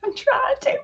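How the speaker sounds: very high, thin voice choked with tears; real crying, words squeezed out; squeaky teary voice. Real, not acted. After a reading moves her to tears, a very high, tear-choked squeak.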